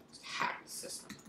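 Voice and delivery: conspiratorial whisper; sneaky whisper. Pretend. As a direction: whisper it like a secret plan.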